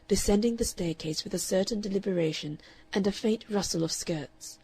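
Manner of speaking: usual speaking voice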